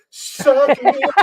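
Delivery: slow, high drawn-out taunt. Real, not acted. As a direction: gloat at Brian slowly. Taunting a friend.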